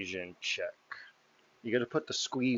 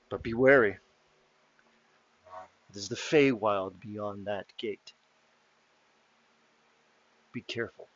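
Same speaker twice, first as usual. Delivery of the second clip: solemn warning. Acted. NPC warns party about Feywild.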